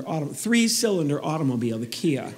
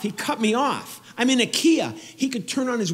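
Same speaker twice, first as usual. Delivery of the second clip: comic outrage; 6 semitones higher than usual. Real, not acted. Comic blasting the Hummer with raised indignation. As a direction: shout out your tiny car.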